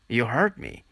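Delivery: ordinary delivery, nothing marked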